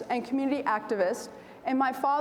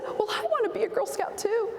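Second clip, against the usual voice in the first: put-on eager child; 7 semitones higher than usual. Child self voiced eagerly.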